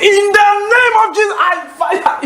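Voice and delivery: high-pitched shouted rebuke in prayer; mock-urgent deliverance shout; re-enacted prayer shout. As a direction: cry it out like casting out evil. Re-enacting prayer against a cat.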